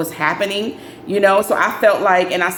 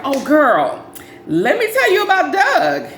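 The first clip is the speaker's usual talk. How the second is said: higher, put-on voice of a gossiping girlfriend; gossiping friend; 9 semitones higher, slower than usual. Friend at church teasing news about Doug, in a high gossipy voice. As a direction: play the friend spilling juicy news. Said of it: "she was like".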